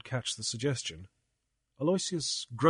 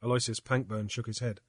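ordinary delivery, nothing marked

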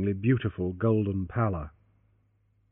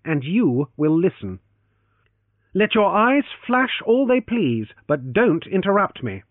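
changed tones; 8 semitones higher, 5 dB louder than usual. Acted. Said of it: "he said in changed tones".

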